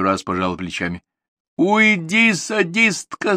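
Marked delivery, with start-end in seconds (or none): 1.6-3.4 high, loud, slow wounded cry; shouting mother. Acted. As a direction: cry it out, driving her off. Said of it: "закричала мама".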